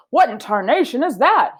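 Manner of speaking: startled old man, voiced lightly and loud; a hint of a startled Mister Rogers. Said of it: "he said".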